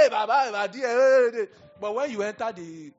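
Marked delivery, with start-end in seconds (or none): 0.0-1.5 very loud, high street yelling; rowdy street holler. Retold or imitated. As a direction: holler rough greetings across the street. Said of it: "your Igbo smoking attitude".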